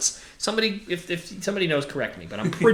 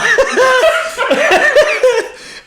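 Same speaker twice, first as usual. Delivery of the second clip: squealing burst of laughter; high-pitched laugh; 7 dB louder than usual. Real, not acted. Cracking up at own accent.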